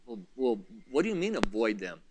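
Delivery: low, hushed imitation of a doubter; very soft, low, halting objection; hesitant questioning. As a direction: play a listener asking under the breath. Voices a hesitant listener's question.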